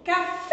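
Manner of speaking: Café with FÉ high, loud and long; stress on FÉ. Shown as a demonstration. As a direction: punch out the final syllable of Café. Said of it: "Qual que é a sílaba tônica". (Café com o FÉ forte e esticado)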